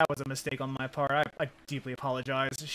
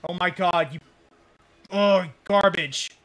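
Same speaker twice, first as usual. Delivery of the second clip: frustrated yell; 3 semitones higher, 7 dB louder than usual. Real, not acted. Frustration at game.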